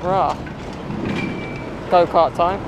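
ordinary talk, nothing marked

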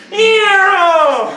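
put-on high, loud baby screech; imitating baby's squeal. Dad imitating his baby's squeal, high and loud. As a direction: mimic the baby's shrill cry. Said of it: "remember how yesterday you went".

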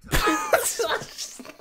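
high, wheezy laugh; cracking up. Real, not acted. Fan reacting to LeBron talk, helpless wheezing laugh. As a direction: laugh until the voice drops out.

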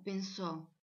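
plain reading voice

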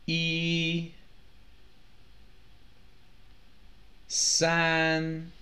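"一、三、七、八" each held on one high, even pitch; high level first tone. Shown as a demonstration. Teacher answering which numbers have first tone, high and sustained. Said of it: "the first tone".

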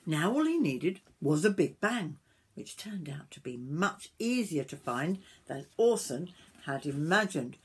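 lively, sing-song storybook reading; story narration to children. A narrator's reading. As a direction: read it aloud to young children.

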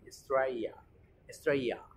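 "Australia" as "Straia"; soft Y for l. Shown as a demonstration. Teacher modelling the target word, "Straia". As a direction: glide "Australia" from stra into ya, no l. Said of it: "la Y griega".

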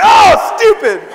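loud high wail, then dropping; retold self-reproach. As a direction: cry out in mock frustration at yourself. Retold self-reproach after squeaky yell.

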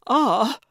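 loud, high answering yell; startled shout. A narrator's reading. Calvin screamed at by Gertrude, shouting back. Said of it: "he shouted back".

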